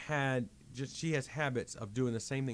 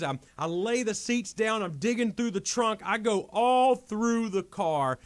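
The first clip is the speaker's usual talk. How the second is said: high, urgent run-on telling; frantic recounting; 5 semitones higher, 5 dB louder than usual. Retold or imitated. Man recounting a car search for coins, frantic. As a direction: pile up each action like a desperate search.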